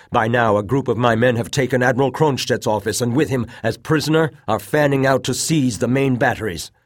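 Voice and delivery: loud, high, defiant claim; resolute defiance. A narrator's reading. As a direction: push back with resolve. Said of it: "shaken but still resolute".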